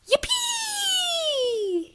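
tiny tadpole squeals with joy; high excited squeal. Acted. Tadpole cheering at his age. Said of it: "he said".

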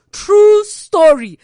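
loud, slow, high; comic insisting it really happened; emphatic aside. Real, not acted. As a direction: hammer it home to the crowd. Punchline emphasis to audience.

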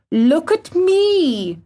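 high, slow, drawn-out cry; flung out. A narrator's reading. Boy standing upright challenges the gardener, defiant. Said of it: "he flung up at Ben Weatherstaff".

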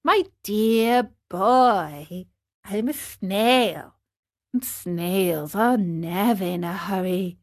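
slow opening, placid reply; calm, peaceful snail. Acted. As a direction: take your time, utterly unhurried. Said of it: "in a calm, peaceful voice".

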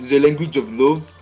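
put-on "the language" said as "ze language", French accent. Praised by friend, a playful French accent line. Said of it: "that was so good".

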